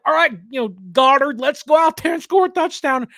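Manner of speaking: put-on rally your robot dog like a boy genius; cartoon boy hero.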